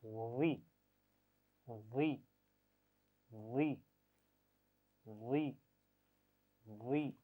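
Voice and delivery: वी repeated slowly and softly; वी said softly, spaced out; drill. Shown as a demonstration. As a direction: model the sound. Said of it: "ज़ुबान लगाइए मखरज पर और पढ़िए".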